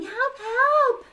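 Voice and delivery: high, loud call for help; cry for help. Acted. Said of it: "voices crying".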